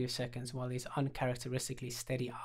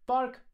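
dog bark; 12 semitones higher, 4 dB louder than usual. Acted. Dog answers on cue.

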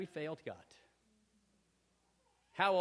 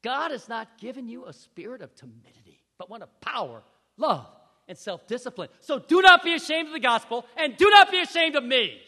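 Paul's charge; 6 semitones higher, 17 dB louder than usual. Retold or imitated. Paul urges timid Timothy, building to a loud plea. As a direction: rise to a fervent command. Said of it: "He says".

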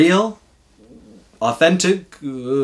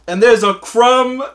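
higher, louder mock scolding; mock stern uncle; 10 semitones higher, 6 dB louder than usual. Uncle telling the boy he broke the crumb rule, mock stern. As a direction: lay down the rule with fake gravity. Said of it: "And he goes".

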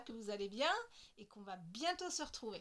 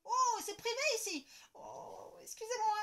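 surprised apology; 10 semitones higher, 8 dB louder than usual. Acted. Embarrassed intrusion.

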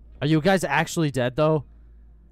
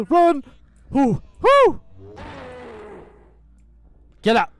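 high, loud whoops; frantic shout; 10 semitones higher, 8 dB louder than usual. Real, not acted. Player running away, frantic whooping. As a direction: yell while fleeing. Fleeing enemies.

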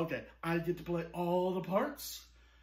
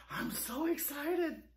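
slower, higher, gushing excitement on "excited"; 8 semitones higher, 5 dB quieter, slower than usual. Shown as a demonstration. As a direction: draw out "I'm so excited" with a thrill.

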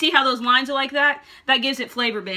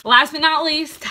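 announcer build-up; 4 semitones higher, 7 dB louder, slower than usual. Acted. Announcing the final fruit.

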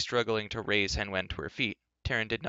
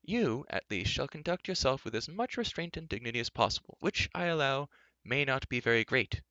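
put-on higher-pitched, measured old man's lecture; admonishing old enchanter; 5 semitones higher than usual. Old enchanter lecturing a rash youth, admonishing. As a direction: admonish with dry authority.

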